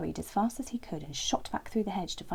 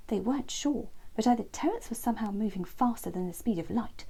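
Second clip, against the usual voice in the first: plain reading voice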